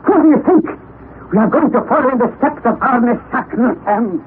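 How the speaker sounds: eager uncle bursting with plans; excited exclamation. Acted. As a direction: fling the answer back with glee. Uncle exclaims about the journey.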